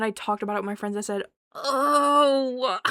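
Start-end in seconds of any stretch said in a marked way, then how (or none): 1.5-2.8 long, louder, higher "oh"; loud dawning revelation; big realization. Retold or imitated. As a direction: draw it out as it all clicks. Bigger realization after talking with friends.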